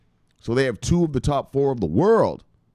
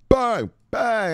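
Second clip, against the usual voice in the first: much higher, punchy bang; hype bang; 11 semitones higher, 3 dB louder than usual. Real, not acted. Host shouting out viewers in the chat, high punchy bang. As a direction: fire it off like a shot.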